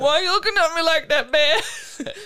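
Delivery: high, loud, laughing plea to a bear; laughing mock plea. Pretending to ask a staring bear, laughing.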